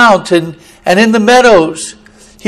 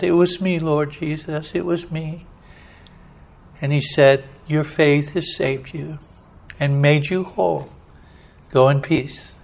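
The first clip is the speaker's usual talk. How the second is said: tender hush; 4 semitones lower, 10 dB quieter than usual. Acted. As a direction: speak it softly like a blessing. Tender exchange with Jesus.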